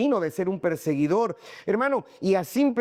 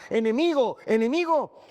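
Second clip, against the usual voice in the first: raised, higher cries of accusation; calling out enemy; 7 semitones higher than usual. Acted. The past crying out against Saulo, raised and higher. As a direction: call it out like an accusing crowd.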